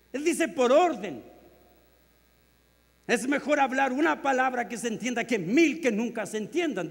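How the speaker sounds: loud, high, driving pulpit voice; fiery sermon delivery; full-force preaching. Acted. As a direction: hammer the point home to the congregation.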